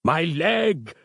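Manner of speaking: high, drawn-out cry of pain; hammed-up pained character voice; wincing in pain. A narrator's reading. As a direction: wail melodramatically over a small wound. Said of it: "he winced".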